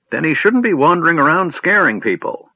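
put-on high, loud indignant old voice; declared angrily. A narrator's reading. Old man outraged that a dead man would scare people, high and loud. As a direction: huff with indignation. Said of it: "the old man declared angrily".

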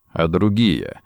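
low, pressing question; firm demand in a dropped voice. A narrator's reading. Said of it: "требовательно спросил Ричард".

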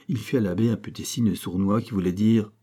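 ordinary delivery, nothing marked

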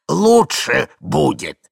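put-on drawn-out, self-excusing old man's voice; forgetful old man. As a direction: ramble on like a doddering old husband. Old man repeats after coughing.

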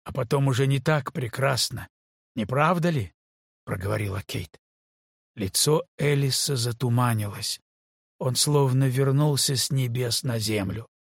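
ordinary delivery

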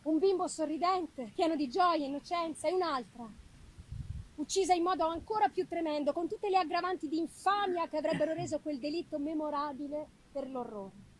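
normal talking voice

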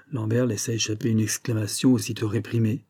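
usual speaking voice

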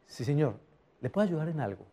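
soft, low-key salesperson reply; demonstrating a calm, hushed service voice. Shown as a demonstration. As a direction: drop the volume to calm an angry customer. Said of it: "tú lo, tú lo bajas".

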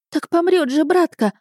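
put-on high-pitched boyish protest; put-on outraged lad; indignant boy. A narrator's reading. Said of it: "возмутился старший".

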